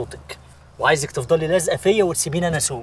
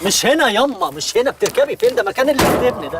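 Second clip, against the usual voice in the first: exasperated scolding; 7 semitones higher than usual. Acted. Man scolding the girl for getting into the trunk, exasperated. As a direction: scold her for climbing in the trunk.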